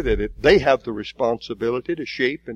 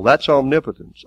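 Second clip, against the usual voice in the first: ordinary talk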